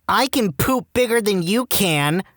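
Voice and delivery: put-on high, slow boastful kid voice; small child. Preschool girl challenging a boy behind the garage, childish boast. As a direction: brag like a preschooler, high and drawn out. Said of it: "we were only three or four years old".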